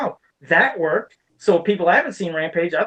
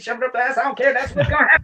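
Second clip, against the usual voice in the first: defiant; 6 semitones higher, faster than usual. Real, not acted. Defiant rant about Lara Croft.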